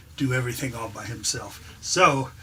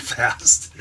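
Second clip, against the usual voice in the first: ordinary talk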